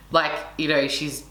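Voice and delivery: normal talking voice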